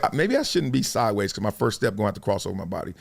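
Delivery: low, hushed inner doubt; privately rethink, soft and low; retold second thoughts. Runner doubting his stance on the line, quietly unsure.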